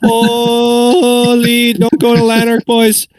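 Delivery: high, loud hammed-up Ottawa Valley brogue; Ottawa Valley boys accent. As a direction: do a broad, high-pitched Ottawa Valley yokel. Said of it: "should hear the boys who sound like this".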